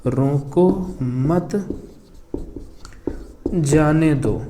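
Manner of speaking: very slow, drawn out while writing; slow dictation. Real, not acted. Teacher writing a second example sentence, slow dictation. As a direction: dictate as you write it out. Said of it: "एक और sentence लिख लेते हैं".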